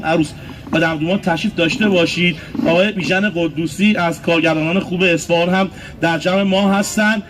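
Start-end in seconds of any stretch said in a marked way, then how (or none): none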